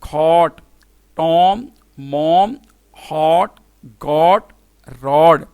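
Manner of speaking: cot, tom, mom each said alone with an open "aw" vowel; word-list drill, hot and got with open short O; short O demo. Shown as a demonstration. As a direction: isolate each word, drawing out the O in rod. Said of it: "फिर मैं repeat कर देता हूँ".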